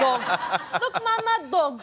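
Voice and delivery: "Dog" in a high, laughing mock Russian accent; imitating Russian child accent. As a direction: playfully mimic her daughter's Russian accent. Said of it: "like a Russian accent".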